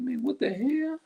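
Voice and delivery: high, squeaky outburst of disbelief; a shrill mock cry of what-the-hell; exasperated disbelief. Fed-up listener reacting to people's demon talk, high exasperated cry. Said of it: "And you're like".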